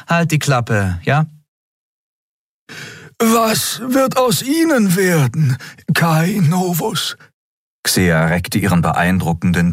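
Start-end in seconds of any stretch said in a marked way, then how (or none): 0.1-1.3 bored. A narrator's reading. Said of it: "begann Endriel gelangweilt". 3.2-7.2 much higher, slow and measured; a tattooed alien questioning calmly; Xia's alien voice. A narrator's reading. Xia asks Kai.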